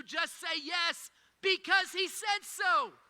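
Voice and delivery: loud, high and very slow; preaching emphasis. Real, not acted. Pastor urging the church to obey, loud and slow. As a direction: hammer each word home.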